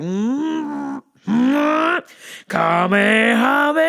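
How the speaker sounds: straining hum building to a loud shout; anime power shout. Pretend. Imagining punching coworker, anime attack shout. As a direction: charge up then blast Kamehameha. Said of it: "like Arthur with the clenched fist".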